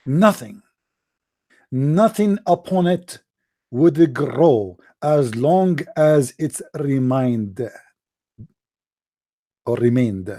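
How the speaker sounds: ordinary talk, nothing marked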